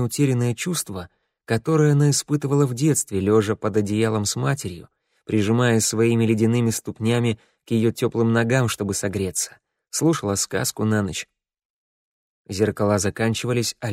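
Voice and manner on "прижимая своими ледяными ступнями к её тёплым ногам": usual speaking voice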